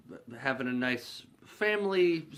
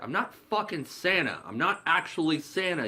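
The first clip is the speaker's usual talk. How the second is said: raised pitch, mock exasperation; exasperated denial; 6 semitones higher than usual. Willie correcting the kid about Santa, exasperated. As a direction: insist with imitated annoyance, pitched up.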